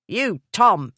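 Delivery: loud warning call; sharp cry. A narrator's reading. Maid stopping the footman lunging at Radford, sharp cry. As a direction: snap the footman's name. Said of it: "the maid cried".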